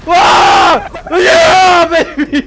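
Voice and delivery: loud victory yell; excited shout. Real, not acted. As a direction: whoop it up. Celebrating a kill.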